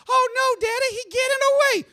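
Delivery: put-on very high, loud girlish falsetto; panicked daughter voice. Daughter warning Daddy in a skit, high panicked voice. Acted Southern daughter in bit.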